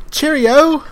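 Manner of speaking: high, loud mock British call; hammed-up British sign-off; British impression. Shown as a demonstration. Podcast host joining the voices game, mock British accent. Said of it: "I thought you were British".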